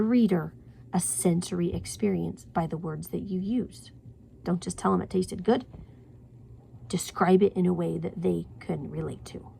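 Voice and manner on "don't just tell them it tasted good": ordinary delivery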